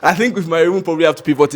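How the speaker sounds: fast, laughing as you talk. Real, not acted. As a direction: laugh through the line. Laughs at audience joke.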